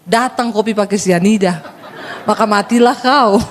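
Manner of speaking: ordinary talk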